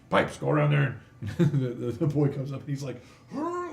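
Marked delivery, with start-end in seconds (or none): 0.1-1.0 loud, raised command; constable's holler; dwarf ordering his helper. Acted. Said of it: "He goes". 3.3-3.7 high-pitched heave of effort; acted strain under a heavy load; squeezed lifting groan; straining grunt. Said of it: "like, trying to carry this".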